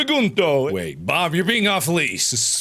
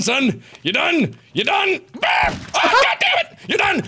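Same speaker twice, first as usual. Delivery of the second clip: usual speaking voice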